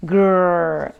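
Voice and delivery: short loud playful growl; toy growl. Acted. Repaired toy dinosaur growling, playful growl.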